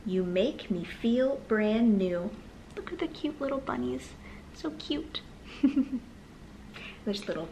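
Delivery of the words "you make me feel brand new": ordinary delivery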